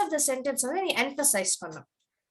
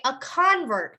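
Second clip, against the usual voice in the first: "convert" as slow CON-vert; weight up front on "convert"; stress on first syllable; much slower than usual. Shown as a demonstration. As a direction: model the noun "convert", long CON. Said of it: "If it's a noun, you would say".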